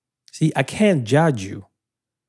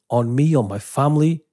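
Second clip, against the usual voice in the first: ordinary delivery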